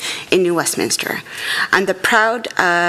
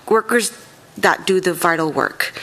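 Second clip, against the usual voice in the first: ordinary delivery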